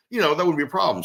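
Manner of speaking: normal talking voice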